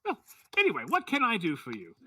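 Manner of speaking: high, loud character voice; eccentric little tinkerer, brisk. Acted. Halfling asking visitors what they need, high and eager. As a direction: brush it aside and offer help, high and loud.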